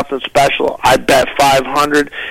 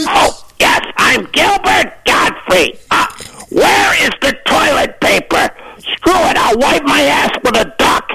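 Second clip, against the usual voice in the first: Gilbert Gottfried; 13 semitones higher than usual. Acted. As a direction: yell it out like a screechy stand-up, drawn-out. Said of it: "I do Gilbert Gottfried".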